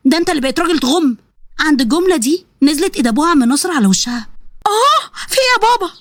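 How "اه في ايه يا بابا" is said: high pained yelp then a stunned question; shocked cry after a slap. Acted. Daughter slapped by her father, crying out high in shock.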